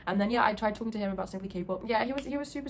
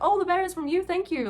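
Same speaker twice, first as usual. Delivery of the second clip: higher-pitched, delighted retelling; excited thanks; 10 semitones higher, 5 dB louder than usual. Retold or imitated. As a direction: imitate someone thrilled by a gift. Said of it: "he got really excited".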